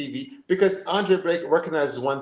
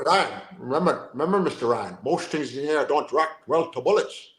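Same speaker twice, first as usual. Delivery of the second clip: put-on Sean Connery Scottish accent. Host asks for Scottish, he gives a light Connery accent. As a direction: do a mild Sean Connery burr. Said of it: "my Scottish accent".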